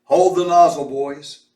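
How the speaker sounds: loud firm order; commanding call. A narrator's reading. Mose orders his men to hold the hose, loud firm call. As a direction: call it to the crew. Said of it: "Mose said".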